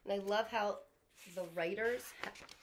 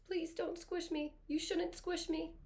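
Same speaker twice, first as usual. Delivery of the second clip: ant's plea; 8 semitones higher than usual. Retold or imitated. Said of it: "the ant's opinion is".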